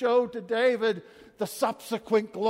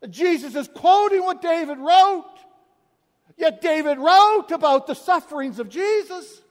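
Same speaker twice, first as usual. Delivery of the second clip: high, loud preacher's proclamation; full-force preaching; 5 semitones higher, 10 dB louder than usual. Acted. Preacher driving the point home to the congregation, loud. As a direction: proclaim it to the whole church.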